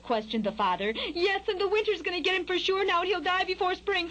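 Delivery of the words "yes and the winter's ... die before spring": high, dramatic bird mom; high-pitched worried mother bird; fretting mother bird. A narrator's reading. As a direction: predict doom in a high, agitated voice.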